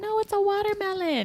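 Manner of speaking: put-on very high girly correction; mock girl, matter-of-fact; high girl voice insisting; girl correcting. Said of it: "another girl's like".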